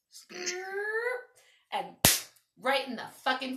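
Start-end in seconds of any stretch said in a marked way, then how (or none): 0.1-1.2 high sound-effect of the snowball hitting; snowball hit noise. Retold or imitated. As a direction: make the splat of the ice ball. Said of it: "I'm like".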